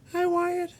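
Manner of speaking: a tiny puppet squeaking hello; monkey puppet voice. Acted. Fred the puppet greets nephew Wyatt, very high squeaky voice. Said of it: "He's a monkey".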